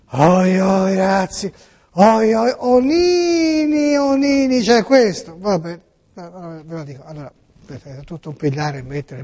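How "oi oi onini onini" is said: high, slow, drawn-out fake whimper; mock whimpering. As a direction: whine like a caught child, hammed up. Mock whimper before confessing.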